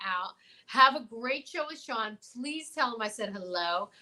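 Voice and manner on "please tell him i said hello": ordinary talk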